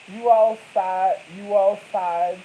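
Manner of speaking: drawn out, loud accusing; calling her out, slow and loud; slow accusation. Retold or imitated. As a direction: stretch each word out.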